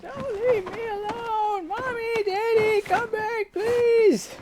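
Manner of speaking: high, slow, loud wail; a girl screaming for her parents to return; high anguished child cry; desperate crying child. Acted.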